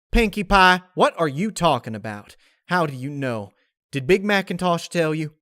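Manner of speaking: pressing farm pony demanding answers; Applejack cutting in. Acted. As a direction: interrupt her, desperate to know. Applejack cuts Pinkie off.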